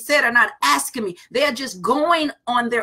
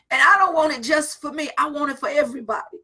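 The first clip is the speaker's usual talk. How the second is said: ordinary talk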